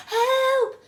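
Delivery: shrieked, very high and loud; terrified scream for help. A narrator's reading. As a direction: yell in sheer panic as you fall. Boy slipping into mid-air.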